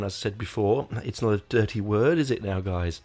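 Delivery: ordinary talk, nothing marked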